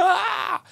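a sudden high yelp of shock; a realization jolts him into a high cry; startled gasp. Retold or imitated. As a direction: yelp as if hit by the truth. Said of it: "And you go".

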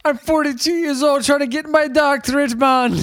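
high, projected, hammed-up complaint; put-on character voice. Podcaster imitating man chasing doctorate, hammed-up complaint. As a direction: whine it in a put-on movie accent. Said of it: "why is he speaking like".

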